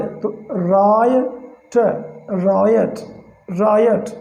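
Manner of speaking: रायट drawn out very slowly as "rye-ut"; slow riot. Shown as a demonstration. Teacher slowly modelling रायट for learners. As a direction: stretch रायट slowly, first vowel आय. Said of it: "आई ओ का हो गया आय".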